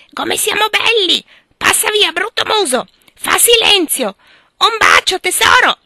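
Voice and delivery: high, loud parrot squawk. Acted. Parrot showing off while strutting behind Amy, loud squawk. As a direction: screech it like a proud parrot. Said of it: "fermandosi ogni tanto per esclamare".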